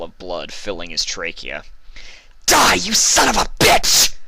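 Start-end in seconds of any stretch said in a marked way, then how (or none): 2.5-4.1 high, loud battle scream; furious cry. Acted. Vinyl screams at the buffalo as she kills him. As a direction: scream it as the killing blow lands. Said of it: "Vinyl cried out".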